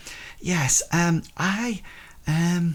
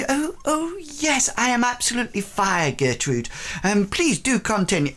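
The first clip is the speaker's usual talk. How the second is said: high, airy lady of the house; lady's voice; 11 semitones higher than usual. Acted. Lady reassuring her cook in a raised voice. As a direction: answer lightly like a gracious lady.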